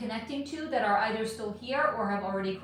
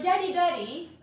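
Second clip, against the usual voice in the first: begging daughter voice; 8 semitones higher, 5 dB louder than usual. Retold or imitated. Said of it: "I can't do a Russian accent".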